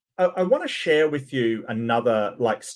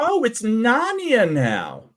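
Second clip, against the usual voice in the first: sarcasm; 6 semitones higher, slower than usual. Real, not acted. Mocking pastor's Narnia vision.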